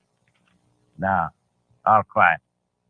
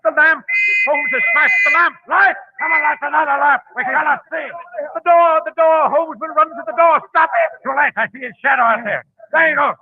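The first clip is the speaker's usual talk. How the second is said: high, loud frantic yelling in the dark; panicked shouting; 10 semitones higher, 3 dB louder than usual. Acted. Gang leader yelling orders as police storm the shop, panicked shouting. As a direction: shout orders as the raid breaks in.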